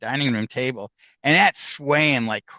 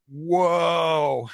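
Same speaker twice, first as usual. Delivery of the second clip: stunned reaction; 3 semitones higher than usual. Retold or imitated. Retells team's stunned reaction.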